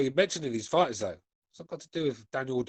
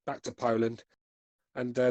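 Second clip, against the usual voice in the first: ordinary talk, nothing marked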